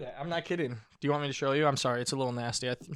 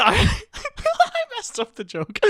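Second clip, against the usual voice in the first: high, slow words through laughter; laughing; much slower than usual. Real, not acted. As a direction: laugh while admitting the flub. Laughing through own mistake.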